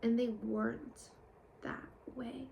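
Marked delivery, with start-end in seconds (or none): 1.6-2.5 whisper. Real, not acted. Trailing off about the past.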